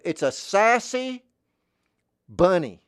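high, loud, drawn-out sassy cartoon voice; sassy bunny. Shown as a demonstration. Asked to voice a sassy bunny, high cartoon sass. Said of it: "Another voice I like to do".

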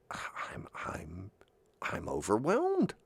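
soft, halting, starting in a whisper; hushed overwhelm. Acted. Person shown unexpected love, hushed and overwhelmed. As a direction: barely get the words out, awestruck.

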